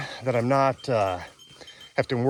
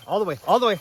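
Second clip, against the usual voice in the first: calling the dog; 8 semitones higher, 3 dB louder, faster than usual. Real, not acted.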